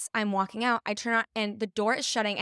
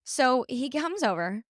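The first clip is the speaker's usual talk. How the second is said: usual speaking voice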